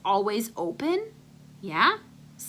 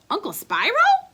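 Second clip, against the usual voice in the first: higher, louder call; calling through a door; 11 semitones higher, 6 dB louder than usual. Acted.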